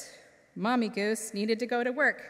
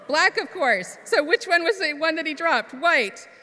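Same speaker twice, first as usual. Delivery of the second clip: correcting herself; 6 semitones higher, 7 dB louder than usual. Real, not acted. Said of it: "I got a little lost".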